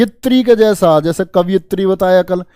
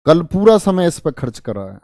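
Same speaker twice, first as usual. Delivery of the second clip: ordinary talk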